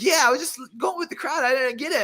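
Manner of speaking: high gushing voice of a fan; old lady fan. Retold or imitated. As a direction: gush like an apologetic sweet lady. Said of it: "She's like".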